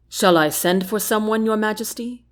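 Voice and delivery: usual speaking voice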